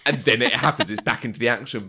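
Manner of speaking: ordinary talk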